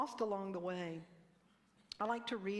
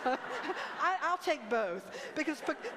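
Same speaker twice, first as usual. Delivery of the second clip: real laugh, louder, breaking through the words; 6 semitones higher, 8 dB louder, slower than usual. Real, not acted. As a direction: laugh at your own slip, higher and louder. Laughs at her own Picasso slip.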